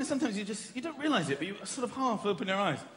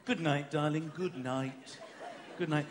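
low, soft loving murmur; tender bedtime goodnight; 8 semitones lower, 6 dB quieter, slower than usual. Acted. Childless partner to darling at bedtime, tender. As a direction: lull a partner to sleep, low. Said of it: "This is how people without children".

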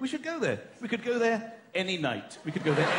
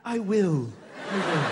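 low, smug assurance; cocky reply; 5 semitones lower, 3 dB louder, slower than usual. Acted. Childless partner answering sleep well, cocky and smug. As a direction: answer with arrogant certainty. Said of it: "That's how cocky, how arrogant you are".

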